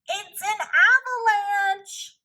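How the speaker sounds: high alarmed cry; frightened cry. Acted. Toad warning Frog of falling stones, high alarmed cry. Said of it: "cried Toad".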